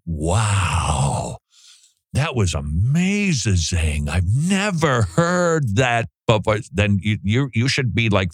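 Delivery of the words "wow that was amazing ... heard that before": slow, stretched-out mock amazement; awestruck listener. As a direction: play a wowed follower, dragging the key words. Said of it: "and you go".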